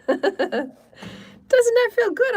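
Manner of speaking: high, giggling and drawn out; laughing. Real, not acted. Healer giggling to listeners after the cleansing, laughing. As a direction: laugh through the question.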